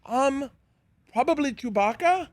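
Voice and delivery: high, hesitant mock voice; imitated unsure co-star. Co-star guessing a favourite character.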